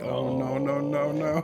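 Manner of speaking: mock dread. Acted dread at delivery guy's offer.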